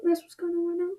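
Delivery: ordinary delivery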